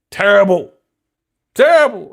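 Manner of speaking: loud, rising outcry; outraged double shout; loud disgust. Retold or imitated. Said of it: "I was like".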